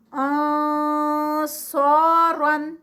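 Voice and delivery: آنصارن slow with a full heavy nasal before ص; heavy ikhfa recitation. Shown as a demonstration. Teacher shows heavy ikhfa before the letter saad. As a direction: recite آنصارن slowly and heavily. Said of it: "اخفا ہے اور heavy ہے".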